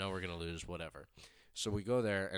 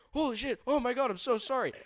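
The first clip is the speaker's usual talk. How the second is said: much higher, louder flustered apology; panicked dad; 9 dB louder than usual. Retold or imitated. Dad finding his kid under the stall door, high flustered apology. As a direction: blurt it like a mortified father. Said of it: "the dad's like".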